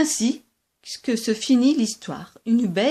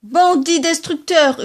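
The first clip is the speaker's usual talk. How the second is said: higher, louder yelled insult; shouting; 5 semitones higher, 5 dB louder than usual. Acted. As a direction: hurl the insult. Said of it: "hurlait monsieur Brun". (insulte hurlée, plus forte)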